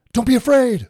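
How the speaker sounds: high, very loud call; instant shouted reply. Retold or imitated. Jesus answering the frightened disciples at once, instant shout. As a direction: shout it straight back without waiting. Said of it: "I mean, that's at once".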